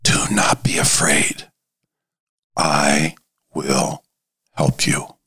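low, slow near-whisper of reassurance; hushed whisper. Acted. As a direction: whisper it gently, word by word, pitched low. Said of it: "he whispers".

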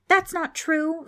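high, loud, drawn-out protest; sudden shout. A narrator's reading. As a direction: cry out in indignant denial. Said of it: "Frank suddenly shouted".

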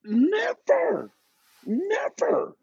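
high, drawn-out disbelieving never; emphatic never. Real, not acted. Host asks about owners, high disbelieving never. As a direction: cry out the word in disbelief.

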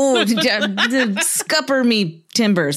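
put-on stumbling, laughing pirate accent; hammed-up West Country pirate accent; Long John Silver. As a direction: fumble through a slow mock pirate line. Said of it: "I was just like".

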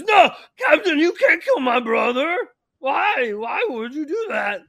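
high, loud pleading yell; anguished protest. Acted. Albert begging the captain for his brother's life, anguished yelling. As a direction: protest at the top of your voice.

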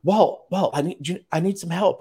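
high, loud pleading call of his name; needy manager calling. Retold or imitated. Managers pleading with their boss on WebEx, high and loud. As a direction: play a manager begging for help, pitch up. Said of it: "would be like".

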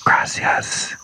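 brief, hushed whispered thanks; imitating her gentle whisper; soft whisper. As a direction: whisper it very softly, like a private thank-you. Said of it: "she whispers, just very briefly and very softly".

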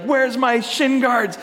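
high, slowed, drawn-out demand; demanding daughter. Retold or imitated. Daughter interrupting dad's phone call, imitated demanding whine. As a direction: imitate a child insisting on an answer.

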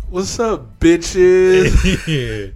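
mock diva greeting. Quoting blonde girl.

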